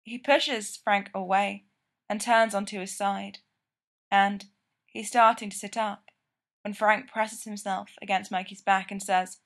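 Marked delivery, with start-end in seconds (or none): none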